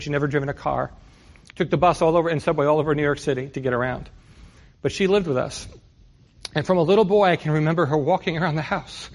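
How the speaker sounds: normal talking voice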